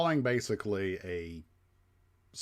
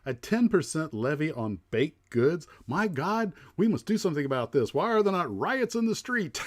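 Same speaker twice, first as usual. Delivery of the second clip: mock outrage; 9 semitones higher, 7 dB louder than usual. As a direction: play a scandalised gentleman raging at a tax. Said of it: "He goes".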